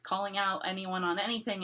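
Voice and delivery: usual speaking voice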